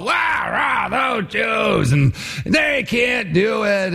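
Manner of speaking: slurred, high rowdy mob shout; drunken mob. Acted. Drunken enemies of the wall jeering along, rowdy. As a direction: holler it like a drunk crowd, dragging the end. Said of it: "sort of a drunken sort of mob scene".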